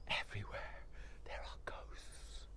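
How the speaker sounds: slow, very soft unvoiced whisper; ghostly whisper. Wife whispering to husband about the ghosts. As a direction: hush it like a secret.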